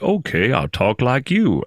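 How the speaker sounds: copying the Arkansas doorman's drawl; slow Little Rock imitation; Little Rock accent.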